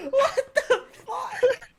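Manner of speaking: very high, slow laughing wheeze; incredulous laughter. Real, not acted. Friend hearing about the calendars, helpless incredulous laughter. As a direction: barely get it out for laughing. Cracking up at the story.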